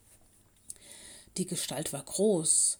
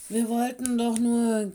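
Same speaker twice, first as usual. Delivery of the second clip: raised, louder owl protest; mildly croaked, huffy bird voice; sour croak; 4 semitones higher, 5 dB louder than usual. A narrator's reading. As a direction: protest sulkily as a little owl. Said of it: "krächzte Coco sauer".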